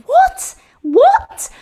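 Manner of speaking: high incredulous double what; shocked what. Retold or imitated. Pundit baffled at being called South African, a stunned high what. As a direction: squeak it out in disbelief. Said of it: "I'm like".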